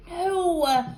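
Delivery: usual speaking voice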